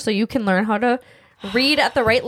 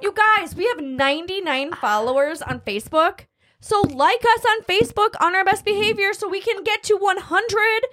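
high, pushy sales pitch; upbeat promo plea; 5 semitones higher than usual. Real, not acted. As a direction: hype up the listeners.

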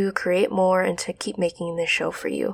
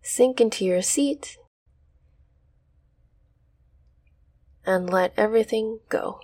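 guided meditation. Acted. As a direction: ease them into stillness.

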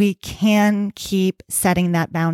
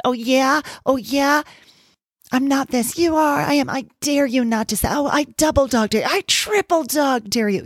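put-on higher, childish schoolyard taunt; taunting inner voice; 7 semitones higher than usual. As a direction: egg yourself on like a bratty kid daring you. Intrusive thought voiced as taunting.

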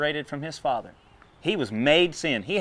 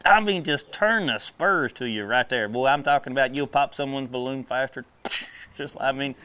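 ordinary talk

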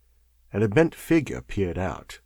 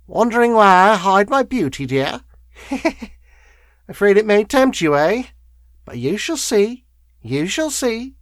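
put-on high cackling old woman; chuckling wheezy crone; 11 semitones higher, 5 dB louder than usual. A narrator's reading. Scarred woman teasing the lawyer about her face, chuckling and wheezy. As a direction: taunt him in a high crone voice. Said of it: "toneless chuckle".

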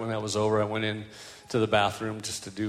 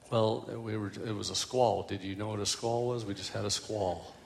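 quiet, subdued excuse; small, muted voice of rebuked followers; sheepish reply; 2 semitones lower, 6 dB quieter than usual. Acted. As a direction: answer softly, as if put on the spot. Disciples answer Jesus sheepishly.

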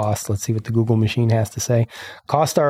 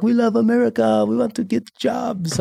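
mock eager newcomer; 10 semitones higher than usual. Host mimicking newcomers to America, high mock-eager voice.

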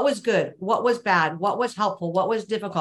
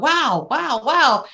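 higher, admiring wow building louder at the end; mock amazement; 5 semitones higher, 4 dB louder, much slower than usual. Admiring a partner's skills, mock amazed wow. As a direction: gasp in admiration at her skill. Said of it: "I look at you regularly and go".

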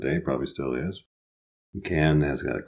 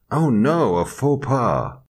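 higher, drawn-out mock dismay; dismayed thought; 8 semitones higher, 3 dB louder, slower than usual. Recalling his own French blunder, mock dismay. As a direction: wail it slowly as a comic inner panic. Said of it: "I thought to myself".

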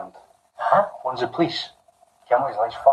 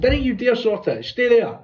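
high, slow urgent command; mock police order; 10 semitones higher, slower than usual. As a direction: order him to freeze, hammed-up. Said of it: "He goes".

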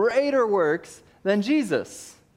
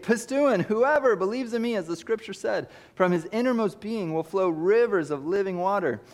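higher, ringing preaching voice; fervent scripture reading; 5 semitones higher than usual. Acted. Preacher fervently reading John to the church. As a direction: proclaim the verse with fire.

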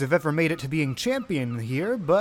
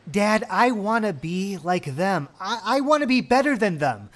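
eager daughter voice, higher and building; determined daughter; 5 semitones higher, 4 dB louder than usual. Acted. Daughter pleading her ambitions to her dad, determined and eager. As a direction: declare big dreams to your dad.

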